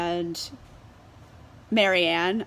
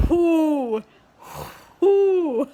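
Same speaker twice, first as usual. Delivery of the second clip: exasperated whoo; 7 semitones higher, 7 dB louder than usual. Real, not acted. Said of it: "I wanna strangle him".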